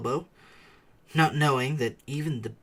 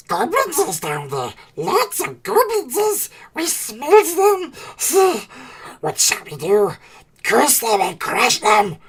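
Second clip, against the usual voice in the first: high, loud, frantic creature voice; Gollum voice; 10 semitones higher, 10 dB louder than usual. Acted. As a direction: Gollum torn and fearful of goblins. Gollum afraid of goblins.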